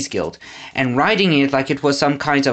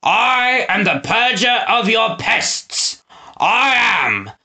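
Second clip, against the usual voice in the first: higher, slow grandiose boasting; boastful proclamation; 7 semitones higher, slower than usual. A narrator's reading. Snatcher boasts to the crowd, grandiose. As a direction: crow it to the crowd. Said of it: "Snatcher boasted".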